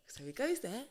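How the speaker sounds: ordinary talk, nothing marked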